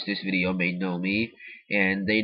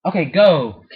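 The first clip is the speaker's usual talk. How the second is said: much louder, higher command; real exasperated order; 7 semitones higher, 10 dB louder, slower than usual. Real, not acted. Speaker shoos sister while trying to film, loud exasperation. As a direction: send someone away with a loud, raised voice. Shooing sister away from filming.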